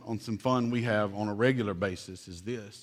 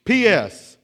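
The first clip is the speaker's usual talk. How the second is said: mock shout, higher and louder; all caps; 8 semitones higher, 10 dB louder than usual. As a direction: bark it out in capitals. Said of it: "all caps, underlined".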